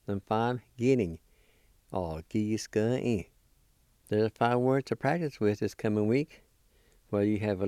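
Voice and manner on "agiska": Agisti said slowly and clearly; slow careful pronunciation. Shown as a demonstration. Teacher repeating the word for learners, slow. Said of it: "I'll pronounce these words one more time".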